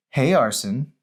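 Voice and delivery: put-on a girl sweetly calling his name. Mandy voiced.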